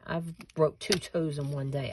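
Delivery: ordinary talk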